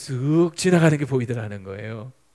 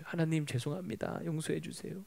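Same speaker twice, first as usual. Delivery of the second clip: penitent prayer; 12 dB quieter than usual. Retold or imitated. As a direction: murmur a humble plea for forgiveness. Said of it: "회개 기도가 나오더래요". (나직이 회개하듯)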